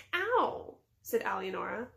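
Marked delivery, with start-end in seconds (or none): 0.1-0.8 high, sudden little "ow"; pained yelp. A narrator's reading. Alienora kicked on the ankle, pained. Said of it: "had hastily kicked her ankle".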